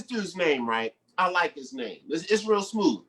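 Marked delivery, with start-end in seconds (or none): none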